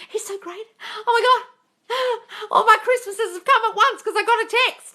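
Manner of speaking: high, loud, gasping and laughing gush; hammed-up lovestruck gasp. Mocking a follower swooning at a text, gasping gush. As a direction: overplay a girl swooning over a text.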